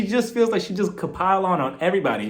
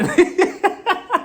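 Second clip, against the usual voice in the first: high burst of laughter; laughing; 13 semitones higher, 4 dB louder than usual. Real, not acted. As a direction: crack up at the quick denial. Laughs at Lisa's denial.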